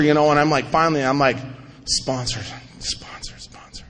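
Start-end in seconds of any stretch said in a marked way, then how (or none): 1.9-3.8 each sponsor softer until only breath is left; sponsor, sponsor, sponsor, dropping from quiet voice to a bare whisper; fading to a whisper. Retold or imitated. Sponsor spoken to himself, fading from a murmur to a whisper. Repeating sponsor in his head.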